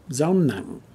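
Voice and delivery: dao nặng with nặng dropped short; dot tone. Shown as a demonstration. Teacher naming the dot mark, falling dot tone. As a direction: let nặng fall heavily. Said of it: "The dot tone".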